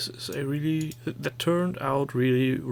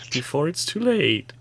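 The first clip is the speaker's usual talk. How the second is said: ordinary delivery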